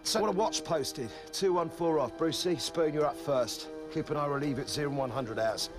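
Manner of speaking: barking orders. Acted. Sergeant issuing orders.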